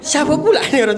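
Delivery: high, loud, laughing protest; laughing confession. Real, not acted. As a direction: insist on it through laughter. Mengaku sambil tertawa bersama pembawa acara. (mengaku sambil tertawa)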